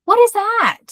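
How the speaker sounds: high, loud incredulous question; mock bafflement. Kids seeing excited mommy, acted high-pitched bewilderment.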